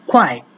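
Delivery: "Quite" as kuài, with a falling fourth tone; Mandarin fourth tone. Shown as a demonstration. Teacher showing learners the tone on kuai, fourth tone. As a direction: drop the pitch sharply through "Quite", said kuài. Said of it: "the fourth tone".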